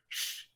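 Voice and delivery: faint unvoiced "Sh" hiss; soft sh sound. Shown as a demonstration. Teacher repeating the sh sound for students. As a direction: hush out "Sh" as the right sound. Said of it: "S, no es R".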